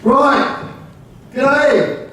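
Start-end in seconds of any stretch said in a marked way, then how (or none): 1.3-2.1 G'DAY as one high, falling word; Aussie greeting. Shown as a demonstration. Teaching a room the first Aussie word, demonstrative Aussie greeting. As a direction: run G'DAY together in a broad Aussie way. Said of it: "the first Aussie word you have to learn".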